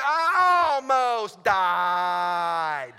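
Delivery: hugely drawn-out, very loud wail; exaggerated repeated yell. Pilot answering his instructor's baffled question, long exaggerated yell. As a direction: bellow it, stretching every word to the limit.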